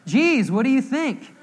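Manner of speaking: loud, high, drawn-out mock exasperation; slow, exaggerated comic indignation; sarcastic retort. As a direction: pretend to hurl back an obvious answer. Repeats imagined sarcastic reply.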